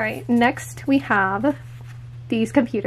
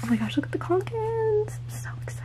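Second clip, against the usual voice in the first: hushed, high, thrilled whisper; whispered gasp of joy; excited whisper; 9 semitones higher, 6 dB quieter than usual. Real, not acted. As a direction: breathe it out in delight. Excited whisper over stickers.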